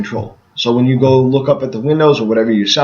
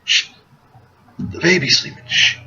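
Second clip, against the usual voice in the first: hushing; 4 semitones higher than usual. Retold or imitated. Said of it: "I have been told a million times".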